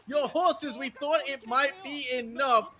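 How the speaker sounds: high, fast-building excited commentary; live play-by-play. Acted. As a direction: hype up the teamfight.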